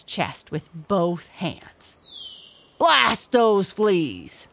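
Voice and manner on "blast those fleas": slow, loud furious roar; raging gorilla. A narrator's reading. Gorilla raging at fleas, slow and loud. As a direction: curse those fleas. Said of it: "he raged".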